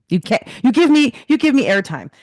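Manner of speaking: high, loud, eager plea for the camera; mock show-off plea. To the cameraman, a hammed-up plea for airtime. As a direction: ham up begging for attention. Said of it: "what I wanted to tell him was".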